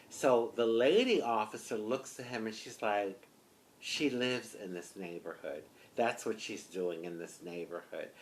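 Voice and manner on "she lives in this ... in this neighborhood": low, soft, firm retort; shutting him down. Retold or imitated. Lady officer correcting the bulldog officer, firm. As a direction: put him in his place quietly. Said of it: "she shut him down".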